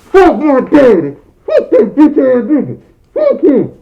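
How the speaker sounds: put-on very high, loud squealing kid curse; muffled kid rage. Boy curses his dad in his room, loud high muffled squeal. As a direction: squeal it furious through a shirt.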